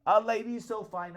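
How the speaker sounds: enraged wife shouting down her husband; shouted in fury. A narrator's reading. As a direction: roar it at the peasant husband. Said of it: "and shouted in fury".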